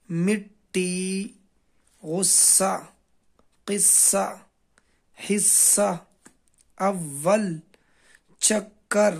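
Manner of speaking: doubled letters drawn out, slowly; slow drill. Shown as a demonstration. Teacher drills tashdeed words slowly for pupils. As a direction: stretch each doubled consonant, very slow. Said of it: "اس کو دو بار پڑھا جاتا ہے".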